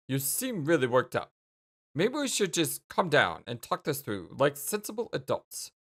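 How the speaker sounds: normal talking voice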